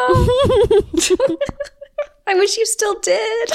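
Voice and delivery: high cooing voice through giggles. Real, not acted. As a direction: coo at her like she's adorable. Teasing cooing over friend's lisp.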